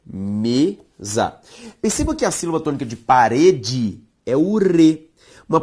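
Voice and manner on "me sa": "mesa" split into me, za; syllable by syllable. Shown as a demonstration. Teacher separating a word for students, "mesa" syllable by syllable. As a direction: sound out "mesa" as two slow, separate syllables. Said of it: "Se eu separo mesa".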